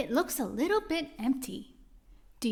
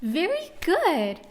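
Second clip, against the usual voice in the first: praise; 6 semitones higher, 4 dB louder, slower than usual. Acted. Teacher praising children.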